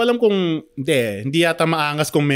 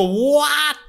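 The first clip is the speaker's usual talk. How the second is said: high-pitched mock outraged cry; retold incredulous what; 11 semitones higher than usual. Cyclist retells answering the rider's insult, high incredulous cry. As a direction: shoot the pitch right up in disbelief. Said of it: "Ginanon ko talaga siya".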